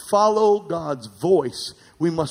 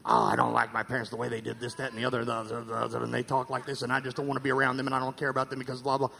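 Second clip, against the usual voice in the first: fast, low, mumbling grumble about parents; mock complaint; 5 semitones lower, 10 dB quieter, much faster than usual. Pastor mimicking people who resent their parents, in a low fast grumble. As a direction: lampoon a sulky grown child.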